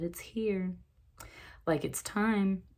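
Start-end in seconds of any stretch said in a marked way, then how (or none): 0.0-0.7 soft, gentle card voice, a little quieter. Retold or imitated. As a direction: breathe it out gently, like a secret. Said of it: "this very gently over here".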